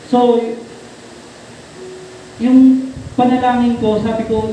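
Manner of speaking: ordinary talk, nothing marked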